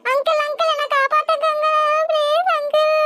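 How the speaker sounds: put-on high child voice, desperate calls for rescue; a small girl screaming for her mother from the water; tearful high-pitched pleading; little girl crying for help. Girl begs man to save her.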